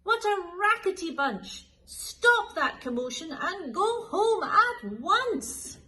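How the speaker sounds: high, loud, slow bellow; furious shouting. Acted. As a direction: roar at the cats from the window. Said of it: "bellowing shouts and a furious roar".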